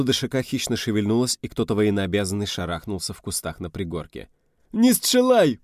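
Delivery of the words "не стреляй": very high, loud panicked wail; terrified shriek with a Polish accent; frightened scream. Acted. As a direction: cry out for your life. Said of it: "пронёсся испуганный вопль".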